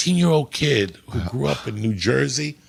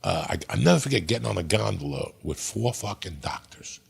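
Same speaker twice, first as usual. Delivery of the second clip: whispering; 3 semitones lower, 7 dB quieter than usual. Real, not acted. Hushed confession about doctors' drugs.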